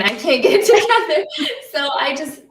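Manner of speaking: laughing through tears; tearful, can't hold it together; crying. Real, not acted. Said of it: "I'm over here ugly crying".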